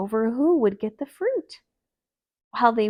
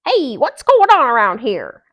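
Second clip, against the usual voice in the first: woken owl; 4 semitones higher, 13 dB louder than usual. Acted.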